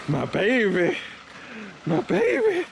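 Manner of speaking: high-pitched cooing over a beloved car; excited cooing. Real, not acted. Owner greeting his car as his baby, excited cooing.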